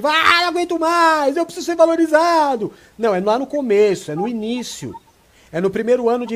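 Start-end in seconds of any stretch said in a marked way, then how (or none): 0.0-2.7 higher, louder mock outburst of exhaustion; a worn-out spouse bursts out, higher and louder; exasperated cry. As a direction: cry out like someone at the end of their rope. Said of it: "dar o grito de".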